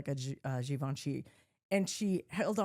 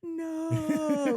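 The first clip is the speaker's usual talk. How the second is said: slow motion no; 7 semitones higher, 4 dB louder than usual. Retold or imitated. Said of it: "watching her in slow motion going".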